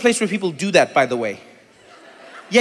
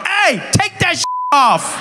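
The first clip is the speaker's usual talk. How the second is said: mock commanding shout; 10 semitones higher, slower than usual. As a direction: yell an order at the bathers. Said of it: "I would have been there tomorrow like".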